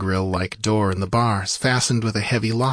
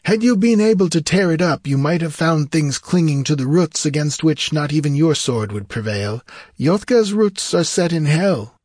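high, emphatic theatrical voice; high-voiced wizard, darkly dramatic; sorcerer giving dire warning; 7 semitones higher, 3 dB louder than usual. A narrator's reading. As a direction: warn him in a high, ominous lilt.

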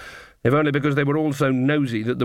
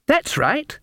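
put-on high, lilting child's reply; little girl; 13 semitones higher than usual. A narrator's reading. Little girl answering Magrat's question, childlike. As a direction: pipe up like a small child.

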